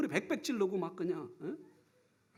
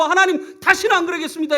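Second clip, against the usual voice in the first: earnest vow; 8 semitones higher, 9 dB louder than usual. Retold or imitated. Prayer vow.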